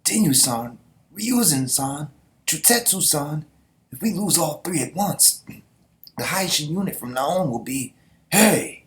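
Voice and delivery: high, rising panic calling names; hammed-up frantic soldier; panicked soldier. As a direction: count the fallen, ending in a loud cry. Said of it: "some random soldier is like".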